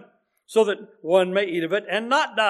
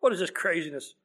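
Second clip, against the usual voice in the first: low, soft grumbling crowd, scoffing; a quiet, scornful grumble; 5 semitones lower, 7 dB quieter than usual. Retold or imitated. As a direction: mutter it like doubters mocking a claim. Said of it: "grumbling and saying".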